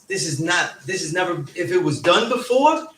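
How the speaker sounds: ordinary talk, nothing marked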